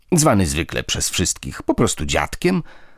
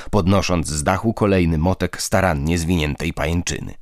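usual speaking voice

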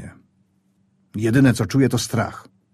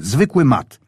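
ordinary delivery, nothing marked

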